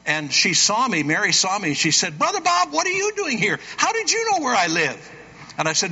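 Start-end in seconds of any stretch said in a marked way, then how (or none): 2.2-5.1 surprised. Retold or imitated. Mary surprised at her door.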